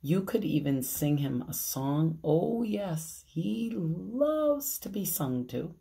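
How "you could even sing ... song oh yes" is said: ordinary delivery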